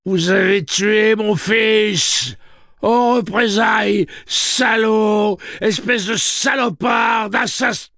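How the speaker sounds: slow, high, screaming insults; furious scream. Acted. Grieving father screams insults at Roland. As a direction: hurl every insult with all you have.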